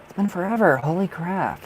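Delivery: light up at seeing them again; excited. Real, not acted. Welcoming back an old viewer, thrilled.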